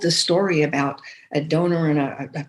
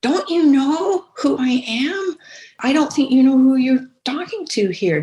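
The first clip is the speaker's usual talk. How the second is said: indignant donor; 8 semitones higher, 5 dB louder than usual. Retold or imitated. Donor fed up with form letters, mock indignant and louder. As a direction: play the insulted major donor getting form letters. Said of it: "And it's like".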